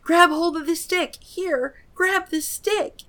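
high, urgent shouting; yelling. Acted. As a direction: yell it at her, high and urgent.

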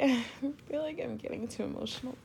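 ordinary delivery

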